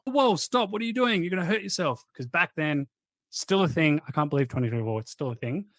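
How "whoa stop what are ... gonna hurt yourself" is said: high, urgent mock alarm at a gym mate; retelling a startled shout of stop, pitched up; imitating a panicked warning, higher. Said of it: "running up to her and going".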